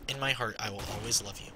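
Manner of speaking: low, quiet, tender repeat; soft mock tender. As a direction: murmur it like a sappy confession.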